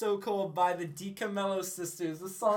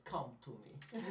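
creepy Dracula voice; 6 semitones lower, 12 dB quieter, slower than usual. Retold or imitated. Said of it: "sound like fucking goddamn Dracula".

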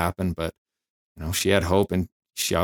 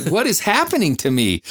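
high-pitched, mock-distraught question; retold sobbing bewilderment; 10 semitones higher, 3 dB louder than usual. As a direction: act out baffled tears, half in jest. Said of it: "I was sobbing".

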